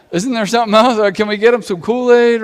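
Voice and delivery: high, loud mock pleading; mock complaint. Preacher mimicking our culture to the congregation, mock complaint. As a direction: raise your voice in an imitated gripe.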